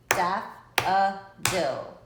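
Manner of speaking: "Daffodil" slow and loud, three separate beats; clapped syllables. Shown as a demonstration. Teacher leading class clapping daffodil, slow and loud. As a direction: drag out "Daffodil" beat by beat. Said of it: "Let's do it one more time".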